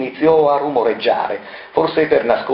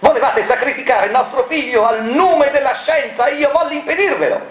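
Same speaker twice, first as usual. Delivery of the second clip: much higher, strange possessed voice; raving; 14 semitones higher than usual. Acted. Marella raves as the dead mother, possessed. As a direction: rave like one possessed.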